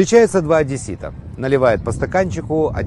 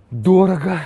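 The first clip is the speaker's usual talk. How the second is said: high, odd character voice; put-on voice; 5 semitones higher than usual. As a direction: drop the punchline in a character voice. Man's punchline, odd voice.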